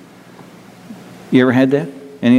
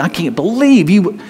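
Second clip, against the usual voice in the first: imitated reproach, pitched up; higher, mildly indignant mimicry; mock disbelief; 7 semitones higher than usual. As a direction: act out an incredulous scolding. Mimics someone reproaching another.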